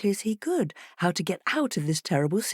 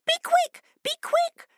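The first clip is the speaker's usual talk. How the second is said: much higher, louder urgent fish; fish crying urgently; 3 dB louder than usual. A narrator's reading. Fish calling to the fleeing fox, urgent and high. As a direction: hurry him along. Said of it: "cried the fish urgently".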